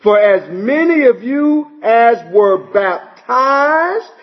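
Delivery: very slow and weighty, every word pressed home; emphatic scripture reading. Acted. Preacher stresses the baptism verse to his church, very slow and emphatic. Reads Galatians verses twenty-seven and twenty-eight.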